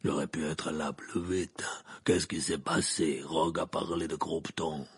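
low, slow growl; gruff, grumbling Moody. Acted. Moody questions Dumbledore after limping up, grumbling.

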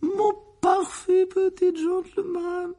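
very high, slow, gushing mother; overcome with emotion. A narrator's reading. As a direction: squeal it, melting with pride. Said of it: "s'exclama la tante Pétunia avec émotion". (exclamation émue, suraiguë)

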